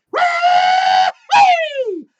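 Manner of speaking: high, loud whoop as he lands; gleeful shout. Acted. Old gnome whooping as he crashes onto the carriage. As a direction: whoop with glee while flying in.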